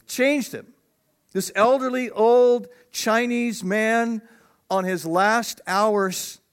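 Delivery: high, loud, slow exultant preaching; full-force preaching. Acted. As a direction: ring it out to the congregation, stretching each phrase.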